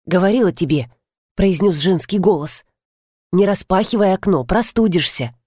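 ordinary delivery, nothing marked

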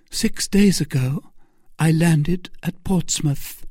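high, earnest voice of a captive lady; wronged woman pleading. A narrator's reading. Milady tells Felton of her misfortunes, high and plaintive. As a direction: plead your case as a persecuted lady, pitched high.

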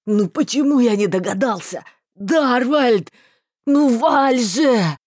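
loud desperate cry, slowing at the end; despair. A narrator's reading. Marston calling his fading friend, despairing cry. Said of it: "В голосе его сквозило отчаяние".

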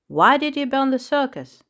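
sharp, demanding question; interrogating. Acted. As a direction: press him hard for an answer. Interrogating the clown.

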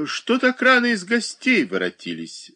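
pulled-face sarcastic question; grimacing question. A narrator's reading. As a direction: ask it with a sneering grimace. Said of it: "спросила с гримасой".

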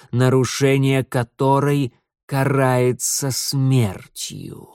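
very slow, ominous lord of darkness; dark lord. Acted. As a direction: intone it slowly, like a dark god. Setil reveals himself.

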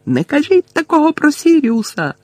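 high-pitched outcry; indignant yell of a character; shouted protest. A narrator's reading. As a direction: cry out in protest, voice shooting up. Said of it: "закричав Гаррі".

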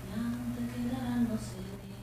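slow, soft, dreamy recitation; hushed poem read far away; poem recital. Acted. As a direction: lay each image down slowly.

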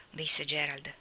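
usual speaking voice

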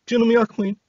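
high, frightened pleading cry; crying out in fear. A narrator's reading. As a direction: beg not to be hurt. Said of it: "diye haykırınca".

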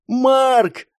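high-pitched shout of a name; calling out. A narrator's reading. Girl shouts to Mark from behind, urgent high cry. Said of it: "крикнула девушка позади".

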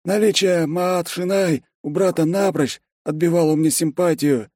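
high-pitched, earnest character voice; smitten secretary, dreamy. A narrator's reading. Secretary praising the boss's wife, high and smitten. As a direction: confide it high, full of admiration.

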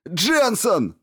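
high, loud shout of her name; yelling. A narrator's reading. Stevens screams at Jensen during surgery, high shout. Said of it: "заорал Стивенс".

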